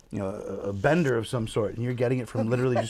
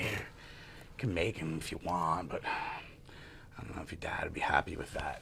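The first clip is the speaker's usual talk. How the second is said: soft whispered aside, much lower; 9 semitones lower, 12 dB quieter than usual. Real, not acted. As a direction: whisper it as a private joke. Joking quietly to tattoo client.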